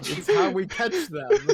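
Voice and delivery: words squeezed out through high laughter; laughing. Real, not acted. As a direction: laugh while you deliver the punchline. Joke cracks up the table.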